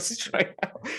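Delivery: laughing. Real, not acted. Laughing retelling escape.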